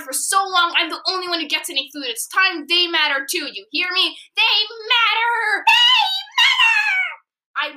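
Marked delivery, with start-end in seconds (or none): 4.4-5.6 angry yell, voice rising; angry shout. Acted. Angry outburst at bully cat. 5.7-7.7 high, loud furious scream. Acted. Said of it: "holding the microphone as far as".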